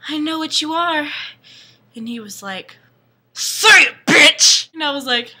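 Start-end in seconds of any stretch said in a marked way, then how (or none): 0.1-1.1 mock dramatic girl. Confrontation in the woods, acted. 3.3-4.7 loud, raised-pitch command; mock angry demand. Vampire in the woods demands she say it, loud mock anger.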